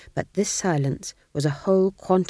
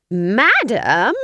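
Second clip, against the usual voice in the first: higher, louder incredulous Madam?; outraged question; 4 semitones higher, 8 dB louder than usual. A narrator's reading. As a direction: fling Madam? back in disbelief. Granny outraged at being called madam.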